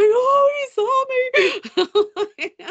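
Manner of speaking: high, loud, drawn-out gushing squeal; starstruck squeal. Retold or imitated. Fan retelling being noticed by a star player, starstruck squeal. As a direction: squeal it out, giddy and swooning.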